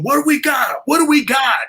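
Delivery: high startled shout; troopers shouting. Retold or imitated. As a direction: bark it out like a spooked trooper. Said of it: "They're going".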